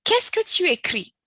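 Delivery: teacher stresses the question; emphatic model question. Shown as a demonstration. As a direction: call out the example question high. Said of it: "go straight to the example".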